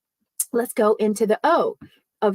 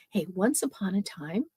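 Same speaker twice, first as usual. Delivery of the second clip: softer, drawing listeners in; hushed storyteller; 3 semitones lower, 7 dB quieter than usual. Shown as a demonstration. Coach showing a story opener to the audience, hushed. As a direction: hush it so they lean in. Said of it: "and you almost lean in".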